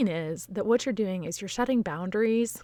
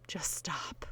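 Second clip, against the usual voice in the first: soft, much quieter whispered plea; whispered just stop; 3 semitones lower, 9 dB quieter than usual. Real, not acted. Host pleading with well-meaning askers, whispered. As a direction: drop to a hush and plead. Begging nosy relatives to stop.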